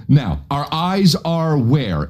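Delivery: mock pedantic lecture. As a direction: talk down like a smug teacher.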